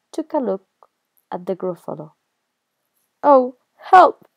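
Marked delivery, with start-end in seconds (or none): 3.2-4.3 alarmed fox. Acted. Fox sees the Gruffalo.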